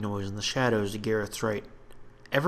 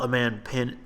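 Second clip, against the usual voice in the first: ordinary delivery, nothing marked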